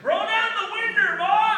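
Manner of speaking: high, loud mock police order; caricatured officer shouting a command; bossy cop. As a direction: holler it like a Texas cop. Cop orders driver at traffic stop.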